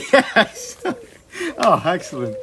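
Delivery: high loud laughing yes. Real, not acted. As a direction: laugh through the words. Laughing at pun.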